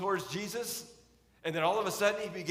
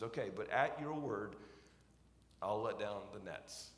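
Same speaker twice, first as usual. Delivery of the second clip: hesitant, skeptical; 6 semitones lower, 5 dB quieter than usual. Retold or imitated. Peter grudgingly agreeing to Jesus, lower, softer, hesitant. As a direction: lower and softer, a reluctant okay. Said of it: "Can you hear a tinge of hesitancy there?".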